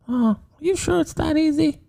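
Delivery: put-on high, hesitant child falsetto; meek little boy David. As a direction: ask timidly in a small boy's voice. Boy David answers the priest.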